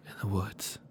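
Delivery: slow, hushed, staring into nothing; dazed whisper. Acted. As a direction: let it drift out in a daze.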